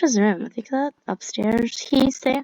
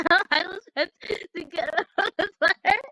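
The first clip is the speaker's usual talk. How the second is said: laughing reading; 7 semitones higher than usual. Real, not acted.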